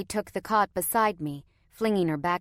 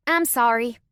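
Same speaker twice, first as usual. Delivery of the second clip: louder, higher childish fake apology; insincere sorry; 6 semitones higher, 7 dB louder than usual. A narrator's reading. Little girl apologizing to her brother, not sorry at all. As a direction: say sorry without meaning it. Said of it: "sounded like she was not sorry at all".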